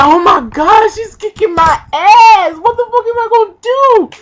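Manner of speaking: high, loud panicked cry. Retold or imitated. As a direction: freak out as the punches land. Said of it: "I'm like".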